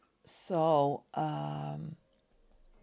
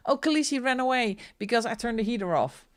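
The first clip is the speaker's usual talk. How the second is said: ordinary delivery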